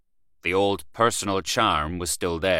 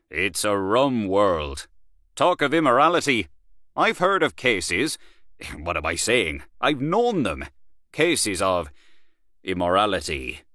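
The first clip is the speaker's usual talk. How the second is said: knowing worldly Gallaher. Acted. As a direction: savour the scandal. Gallaher relishing scandal.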